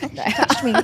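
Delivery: giggling, high; giggly high voice. Acted. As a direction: squeal it with giggles.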